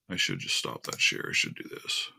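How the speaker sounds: unvoiced whisper under the breath; whisper to self. Real, not acted. Streamer fiddling with settings, whispering to herself. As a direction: murmur it privately to yourself.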